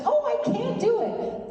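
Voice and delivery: very high, whiny imitation of balking; hammed-up high-pitched protest; mock helpless panic. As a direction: squeal it like a daunted auditioner. Imitating other auditioners balking at accent.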